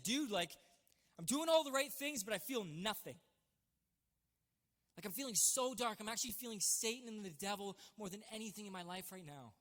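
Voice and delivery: retold outburst, easing off towards the end; worked-up venting. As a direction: pour it out to someone you trust. Retells venting to his dad.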